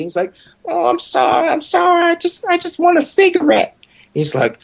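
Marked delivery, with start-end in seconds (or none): 0.6-3.8 very high, squeaky mock apology; high-pitched pleading. Old man apologizing for wanting a cigarette, very high-pitched. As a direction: imitate a cowed old man far up in pitch. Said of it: "has this real high-pitched voice".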